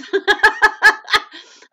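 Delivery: high, loud burst of laughter; laughing. Real, not acted. Host laughing at her own joke about doing magic.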